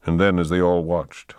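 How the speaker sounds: ordinary delivery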